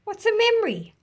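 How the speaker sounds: put-on curious small boy. Little boy asking his parents about memory, high curious voice. Child asks parents a question.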